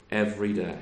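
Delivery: "Every day" repeated quietly, falling. Shown as a demonstration. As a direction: let "Every day" sink into a soft echo.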